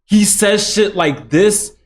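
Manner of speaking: ordinary delivery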